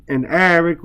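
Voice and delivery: ordinary delivery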